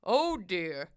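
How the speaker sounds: low-voiced, resigned man; deep, weary dismay; Mister Carp's dismay. A narrator's reading. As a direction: sigh it in a deep character voice. Voices old Mister Carp.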